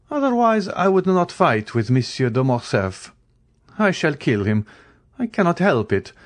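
lofty, assured dismissal; resolute Count. A narrator's reading. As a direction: state the deadly outcome as settled. Count concludes duel arrangements.

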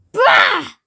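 high, grumpy burst; a sudden disgusted bah; grumbling. A narrator's reading. Mister Grumble greeting another morning, grumbling. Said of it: "he would grumble every morning".

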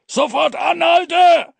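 loud, very high, drawn-out yell; frantic high holler; full-throated bellow. A narrator's reading. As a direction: roar an urgent order at the top of your lungs. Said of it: "brüllte er aus voller Kehle".

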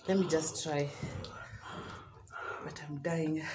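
quiet. Real, not acted. Exhausted by spice.

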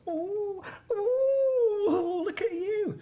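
high, squealing ooh of mock admiration; mock-gushing over his new job. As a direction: coo over him teasingly. Admiring boss's promotion.

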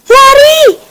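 loud, very high shout of warning; high-pitched alarm call; urgent cry. A narrator's reading. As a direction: yell for everyone to flee. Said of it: "seru Yaki".

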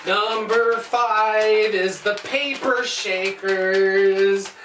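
very slow, stretched-out mock-dramatic heading; playful drawn-out announcement. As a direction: drag out every word like a showy announcer.

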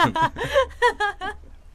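high squealing laugh trailing off; giggling fit. Real, not acted. Laughing at the vague answer. (kıkırdama)

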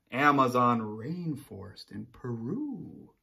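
usual speaking voice

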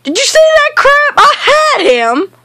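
very high, incredulous shout; outraged yell. Real, not acted. Gamer can't believe he lost his target, outraged yell. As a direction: shriek it in high-pitched disbelief.

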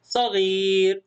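صغير stretched out slowly and clearly; teacher sounding out صغير slowly; slow model reading. Shown as a demonstration. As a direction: spell out صغير for young readers. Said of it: "وهنا".

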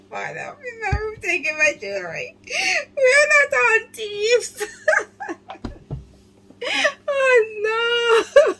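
high tearful laughing; real laughing fit, nearly sobbing; crying with laughter. Real, not acted. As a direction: squeeze words out while in tears of laughter. Reactor laughing through lines.

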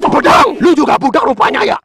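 high, irritated outburst; exasperated correction. Acted. Corrects mishearing "rendang.

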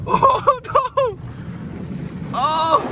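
frightened high-pitched shout; real alarm. Real, not acted. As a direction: shriek as the blast hits. Jets hit hardest yet.

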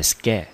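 sca said alone, stressed; first syllable. Shown as a demonstration. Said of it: "第一个音节落在sca".

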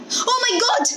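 high alarmed exclamation; a mother suddenly remembering the dog; cried out. A narrator's reading. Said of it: "Missus Fuller cried".

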